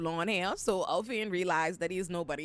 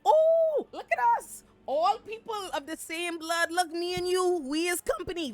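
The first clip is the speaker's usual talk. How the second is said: mock-chummy Ulf; 8 semitones higher than usual. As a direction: needle the prince with fake brotherly cheer, pitched up. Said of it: "Ulf is like".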